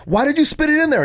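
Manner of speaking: put-on high, loud exasperated yelling at his kid; mock furious dad shouting; retold angry yell. Dad yelling at his son over the spat-in water bottle, high and loud. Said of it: "I turned around and I was like".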